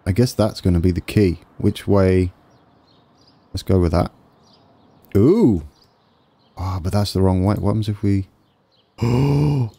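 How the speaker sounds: ordinary talk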